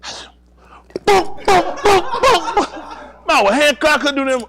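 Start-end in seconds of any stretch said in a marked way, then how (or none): 1.0-2.7 high, punchy booms; imitated blows. Comic imitates police beating him, booming blows. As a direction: fire off high-pitched thuds.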